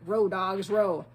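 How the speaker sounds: loud pirate command to row; barked order. A narrator's reading. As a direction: drive the crew through the storm. Captain orders rowers in storm.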